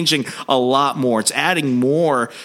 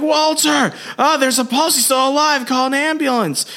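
urgent, much higher cry; desperate relief, pitched way up. Retold or imitated. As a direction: Jackie finding Walter alive and calling for help, urgent and much higher. Jackie finds a pulse.

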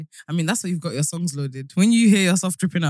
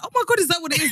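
high, thrilled realization; excited surprise; 12 semitones higher, much faster than usual. Retold or imitated. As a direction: sound suddenly delighted. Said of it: "I was like".

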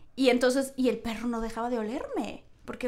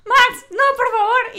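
high, loud begging; panicked plea; 11 semitones higher, 9 dB louder, slower than usual. Retold or imitated. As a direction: beg the dog to go away. Begging the dog to leave. (suplicando al perro con pánico)

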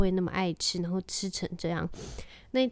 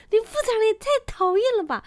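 mock whine; 14 semitones higher, 7 dB louder than usual. Mother snaps back at family.

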